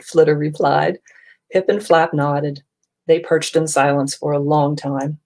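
ordinary delivery, nothing marked

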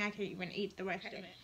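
usual speaking voice

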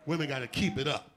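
normal talking voice

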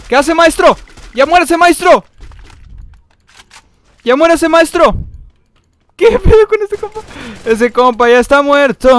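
loud, high-pitched yelling at an opponent; exasperated shouting. Real, not acted. Gamer yelling at an opponent who won't die, exasperated shout.

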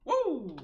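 high, gliding woo; celebratory whoop. Real, not acted. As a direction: whoop for a finished level. Cheers finishing the level.

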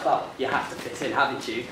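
ordinary talk

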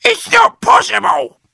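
very high, loud panicked screech; screechy high-pitched wife; Mrs Twit. A narrator's reading. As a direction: shriek it in disbelief. Missus Twit panics at shrinking.

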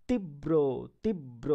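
তীব্র said softly as "tibbro", the ব doubled; pronunciation demo. Shown as a demonstration. Teacher shows students the doubled ব in তীব্র. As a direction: double the ব in তীব্র, "tib-bro". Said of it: "বটা কিন্তু দুইবার হয়ে যাচ্ছে".

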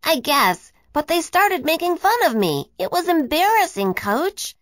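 put-on high, sulky little-boy voice; child sulking to his coach, mildly pouting. A narrator's reading. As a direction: pout it out like an embarrassed kid. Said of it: "Isaac pouted".

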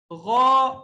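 غا loud and full from the throat; heavy ghain. Shown as a demonstration. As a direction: hit غا thick and heavy. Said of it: "غین کو بھی یہ موٹا پڑھیں گے".

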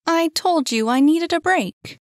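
high, loud protest; exasperated heroine standing firm; indignant insistence. Acted. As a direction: insist loudly, pitched high. Insists after being doubted.

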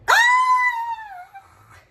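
high excited scream of joy; high joyful squeal. Real, not acted. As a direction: shriek with delight.

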